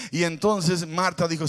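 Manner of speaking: ordinary talk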